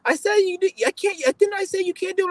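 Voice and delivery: high, mocking impression of a scolding woman; shrill mock of the woman telling him off; mocking the staff woman. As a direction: whine it up high like the nagging staffer. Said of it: "And then she's like".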